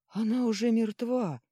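high, loud outcry; alarmed exclamation. A narrator's reading. Companion believes the girl is dead, high loud exclamation. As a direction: cry out the bad news. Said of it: "воскликнул другой голос".

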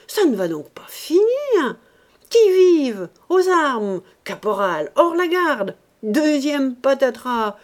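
loud, high mock-military alarm; exasperated outcry. Man finds another hungry child, exasperated outcry. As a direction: raise the alarm in theatrical exasperation. Ursus exclaims over a second child.

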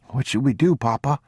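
put-on high boy's voice, questioning; small boy. A narrator's reading. Boy asking his father what to do, high child voice. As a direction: ask Papa like a worried child.

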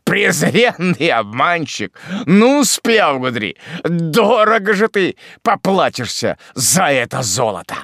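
high, loud, slow threat; furious roaring curse; vengeful shout. A narrator's reading. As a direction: yell a promise of payback. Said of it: "закричал малорослый".

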